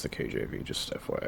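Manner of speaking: low, breathy whispered gripe; whispered complaint about the KJV; whispered aside. Real, not acted. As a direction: mutter the dislike under your breath.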